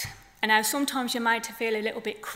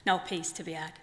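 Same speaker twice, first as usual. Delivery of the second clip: measured poem delivery with long pauses; poem reading. Acted. Poet reading her flamingo poem to the festival audience, measured. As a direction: let each line land, unhurried.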